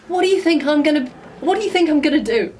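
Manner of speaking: exasperated, rising-pitched appeal. Acted. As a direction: protest in disbelief at being seen as a threat. Exasperated rant at being blocked.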